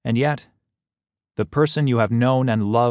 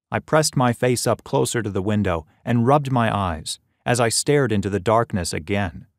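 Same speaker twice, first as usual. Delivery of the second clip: ordinary delivery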